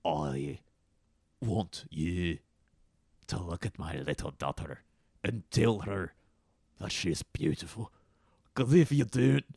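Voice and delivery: halting Scottish burr; Scottish accent. Acted. Scottish father praising his daughter, halting accent. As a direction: pause between phrases in mock Scottish.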